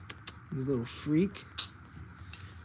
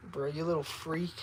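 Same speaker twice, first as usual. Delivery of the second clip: normal talking voice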